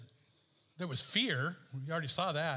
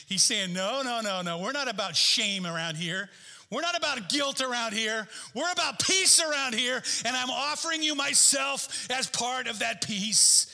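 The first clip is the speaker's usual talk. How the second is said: higher, impassioned preaching; excited preaching; 6 semitones higher, 4 dB louder than usual. Real, not acted. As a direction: preach it with mounting excitement, drive it home. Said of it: "I'm getting so excited".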